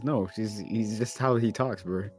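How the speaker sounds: normal talking voice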